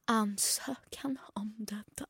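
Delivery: slow, unvoiced struggle through each word; slow struggling reading. Imitating her halting reading at the party, slow and whispered. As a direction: read it out slowly, barely getting through. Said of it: "långsamt försökte ta mig igenom det".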